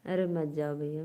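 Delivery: normal talking voice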